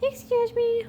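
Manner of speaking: very high, piercing "Excuse me."; mock-posh high "Excuse me."; shrill excuse me. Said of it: "you'll be".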